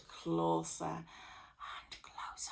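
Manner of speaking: slow hushed whisper. A narrator's reading. Narrator whispering as Jack slithers closer. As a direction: sneak the words out.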